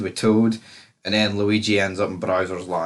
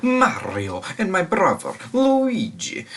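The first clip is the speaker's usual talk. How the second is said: high, swooping cartoon plumber voice; Mario; 9 semitones higher, slower than usual. Host opens the review playing the game hero, hammed-up catchphrase voice. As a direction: announce yourself with big rising-falling swoops.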